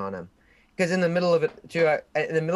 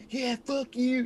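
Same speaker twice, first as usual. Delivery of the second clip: high, mocking defiance; defiant taunt; 12 semitones higher, slower than usual. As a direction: flip them off with your voice. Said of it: "He's like".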